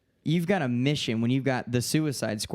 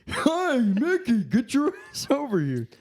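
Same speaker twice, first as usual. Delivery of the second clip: high, louder Goofy barking at Mickey; rude Goofy; 12 semitones higher, 3 dB louder, slower than usual. Pretend. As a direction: crude Goofy shouting for Mickey. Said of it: "You sound like a fuck".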